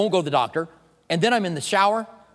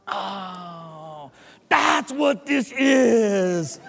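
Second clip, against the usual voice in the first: sudden realization; 3 semitones higher, much slower than usual. Retold or imitated. Realizing it was a kidney stone.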